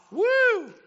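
very high, loud mock cheer; mock whoop over being a slave; sarcastic woo. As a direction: whoop with fake joy. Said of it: "sarcastic cheer".

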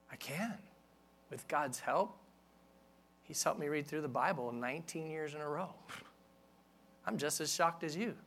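ordinary delivery

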